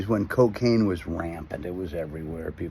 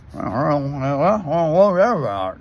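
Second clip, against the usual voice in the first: slurred, high, drawn-out garble; mock speechless mumbling; 5 semitones higher, 6 dB louder, much slower than usual. Passenger asks [name] about dream, garbled imitated struggle to speak. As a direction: imitate words that won't form on coke. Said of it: "I couldn't speak".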